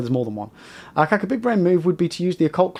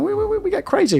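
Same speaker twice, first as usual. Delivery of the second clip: squeaky excited. Real, not acted. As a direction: voice jumps very high on "crazy". Hyped about levelling.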